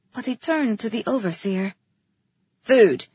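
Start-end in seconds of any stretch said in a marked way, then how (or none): 2.6-3.1 loud one-word command; ordering. A narrator's reading. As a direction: order it like a king. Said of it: "he ordered".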